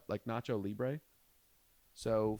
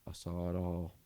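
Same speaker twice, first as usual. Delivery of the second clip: war-weary vet; 4 semitones lower, 4 dB quieter than usual. Retold or imitated. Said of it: "said it like he was a fucking Vietnam vet".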